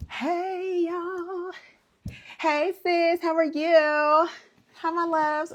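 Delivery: high-pitched hello to viewers; high greeting. Real, not acted. Greeting viewers joining live.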